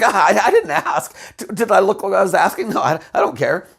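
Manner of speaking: flustered denial. Retold or imitated. She insists to Sanchez she doesn't care, laughing nervously. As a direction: deny caring far too quickly.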